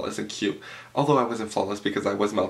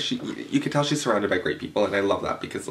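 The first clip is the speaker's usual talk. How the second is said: normal talking voice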